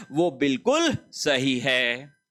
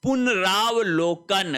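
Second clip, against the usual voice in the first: पुनरावलोकन said slowly, syllable by syllable; slow teacher read of पुनरावलोकन; slow spelling-out; slower than usual. Shown as a demonstration. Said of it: "पुनरावलोकन शब्द में अशुद्धि".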